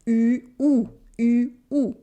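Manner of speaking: "U" as fronted rounded ee, "OU" as back oo; contrasted pure vowels. Shown as a demonstration. Teacher letting learners hear the difference, fronted "U" against back "OU". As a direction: alternate French "U" and "OU", held distinct. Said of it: "to hear the difference between".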